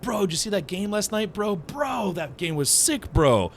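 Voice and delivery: a light parody of a coworker who won't stop saying bro; mock frat bro. Coworker mocked by a colleague, a lightly hammed-up bro voice. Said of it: "who talks like that?".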